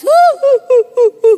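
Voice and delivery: loud, high mock sobs; hammed-up boo-hoo in bursts; imitated sobbing. Imitating the woman crying harder when comforted, loud sobs. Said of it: "Makin nangis".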